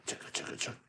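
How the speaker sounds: whispered chugga chugga train sound; breathy choo-train chug for kids; train chugging. Shown as a demonstration. Reading to kids, imitating the engine chugging softly. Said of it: "he went chugga".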